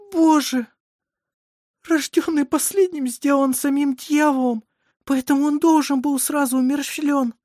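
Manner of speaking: high, anguished lament; horrified despair. A narrator's reading. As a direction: wail in superstitious dread. Mother despairs at the twins' fate.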